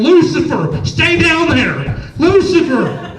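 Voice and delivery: loud, high calling out to a cat, drawn out on down; girlfriend calling the cat. Retold or imitated. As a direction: call the cat back sternly from across the house. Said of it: "you would hear my girlfriend in the background".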